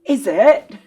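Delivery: loud, mock-doubting challenge; facetious. Colleague needling the pundit about draws, facetious. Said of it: "I'm being facetious".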